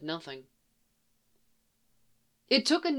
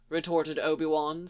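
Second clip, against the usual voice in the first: ordinary delivery, nothing marked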